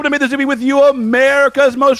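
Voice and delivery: high-pitched hype welcome; podcast host intro. Acted. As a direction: announce the show like a host.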